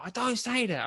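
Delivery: put-on high-pitched protesting Kent accent. Imitating the university friend objecting, high Kent accent. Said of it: "he was from Kent".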